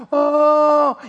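long, high, loud mock wail; imitated child's wail. Preacher imitating a scolded little boy crying, mock wail. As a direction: wail one held note like a crying child. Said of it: "I hear the crying".

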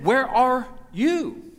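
slow, drawn-out call from God; God slowly calling to Adam. Retold or imitated. God calls out to Adam, slow and searching. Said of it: "And he said".

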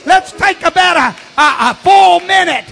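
loud, high shouted praise; preaching at full force. Acted.

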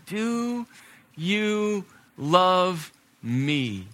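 very slow, word by word; long-drawn, searching question; solemn third asking. Acted. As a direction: stretch every word out, pausing between them.

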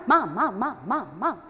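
lightly mimicked pestering child; nagging kid. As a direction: imitate a kid repeating the same call in a steady stream. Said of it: "one of my kids who would go".

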